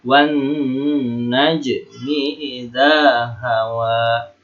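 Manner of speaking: slow recitation, drawn-out n in "Wan najmi", long vowels in "idza hawa"; gunnah. Shown as a demonstration.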